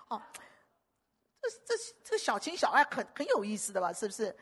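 ordinary talk, nothing marked

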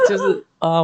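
high squealing laugh into a wail; laughing cry. Real, not acted. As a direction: laugh then whine in mock despair. Laughs at the teasing. (尖声笑着哀叫)

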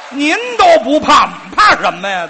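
loud, defiant retort; mock-fierce bravado; defiant shout. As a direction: shout down every objection. Heated back-and-forth of objections.

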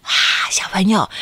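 usual speaking voice

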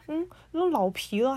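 ordinary talk

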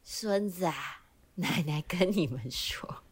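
put-on soft, slow, whispery old grandma; granny voice. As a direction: hush like a granny starting a tale. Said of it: "公奶奶跟我们说故事呗".

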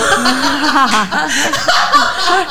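high, long laugh; burst of laughter. Real, not acted. Laughing at the memory.

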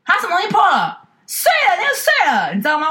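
high, alarmed fussing dad voice; dad's reaction. Retold or imitated. Daughter imitates dad fussing about the broken glass, high alarmed voice. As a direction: squawk in mock alarm about what broke. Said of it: "然后我爸就在旁边说".

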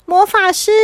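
high-pitched indignant calling; crying out. Acted. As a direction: call out in protest to someone who wronged you. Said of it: "他在心里面大叫".

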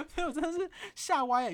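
high, loud laughter spilling into words; laughing. Real, not acted. Admitting the turtle mix-up to viewers, laughing hard. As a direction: crack up at your own silly belief. Laughing at her own mistake.

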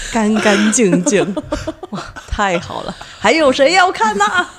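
mock ritual master.

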